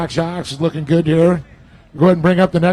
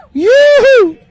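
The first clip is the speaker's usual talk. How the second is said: whoop; 9 dB louder than usual. Real, not acted. Cheering the Aussie team on.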